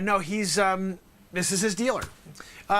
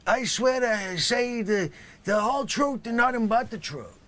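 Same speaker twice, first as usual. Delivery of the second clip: lazy dealer swearing in; flat mumbled oath; 4 semitones higher than usual. Acted. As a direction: mumble it like a stoned witness. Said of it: "not quite good enough there".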